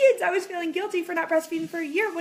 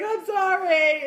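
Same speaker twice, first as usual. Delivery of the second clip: loud, drawn-out mock wail; put-on tearful voice; imitated crying apology; 6 semitones higher, 8 dB louder, much slower than usual. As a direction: bawl the apology, higher and hammed-up. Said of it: "Alex called her back crying".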